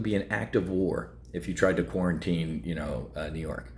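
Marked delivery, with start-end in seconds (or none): none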